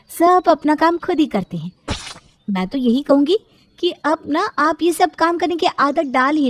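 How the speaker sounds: lightly voiced, lecture him on self-reliance; lecturing sister-in-law. Sister-in-law tells him to do his own work, lecturing.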